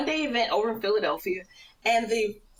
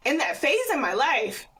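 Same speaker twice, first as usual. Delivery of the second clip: normal talking voice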